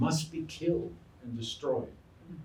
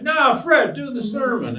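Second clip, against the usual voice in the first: much higher, louder, pushier call; insist, shouting him back onto the stage; insistent crowd shout; 14 semitones higher, 11 dB louder than usual. Retold or imitated. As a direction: crowd member demanding the sermon again. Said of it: "somebody in the audience would say".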